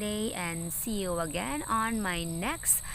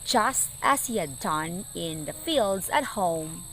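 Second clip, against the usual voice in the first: dramatic storytelling. A narrator's reading. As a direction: tell the battle with rising drama.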